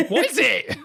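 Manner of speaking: high, loud mock-Cockney question; playful, hammed-up squawk of a question; put-on Cockney banter. Co-host joking into the main topic, hammed-up Cockney.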